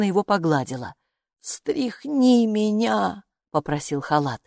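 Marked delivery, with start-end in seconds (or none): 1.4-3.2 very slow, strained request; struggling to speak. A narrator's reading. Stiff robe begs to be shaken, strained slow plea. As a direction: force out each word. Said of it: "Ему явно трудно было говорить".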